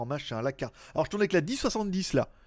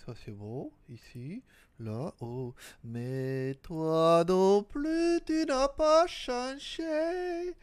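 mysterious voice; 8 semitones higher, 4 dB quieter, much slower than usual. Acted. Intro voice with odd far timbre.

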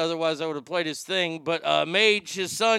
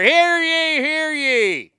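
loud high town crier call; 9 semitones higher, 7 dB louder, much slower than usual. Pretend. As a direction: cry it out like a herald. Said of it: "a town crier".

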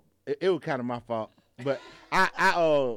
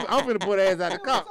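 ordinary talk

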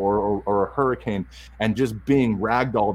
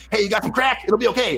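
much higher, wired crackhead voice; strung out crackhead; 11 semitones higher than usual. Retold or imitated. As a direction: play a twitchy junkie blurting it out. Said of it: "a strung out crackhead coming".

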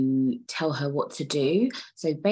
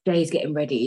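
normal talking voice

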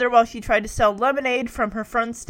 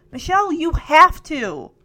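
firm, higher-pitched insistence; stern; 5 semitones higher, 4 dB louder than usual. A narrator's reading. Stephanie presses her sister to confess, stern. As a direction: press the point with mild sternness. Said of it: "Stephanie said sternly".

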